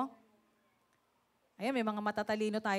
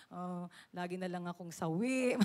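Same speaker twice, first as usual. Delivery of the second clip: self-pity; 5 semitones lower, 5 dB quieter than usual. Retold or imitated. Self-pitying inner monologue.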